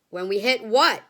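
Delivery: loud alarmed question; crying out. A narrator's reading. Family crying out at Wonka in alarm, loud. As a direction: cry out in alarm. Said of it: "they cried".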